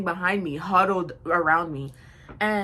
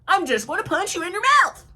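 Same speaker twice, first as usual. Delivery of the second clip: higher and louder, punching the threat; retold threat; 10 semitones higher, 6 dB louder than usual. Threatening the girl at the door, loud. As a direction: yell the threat at her.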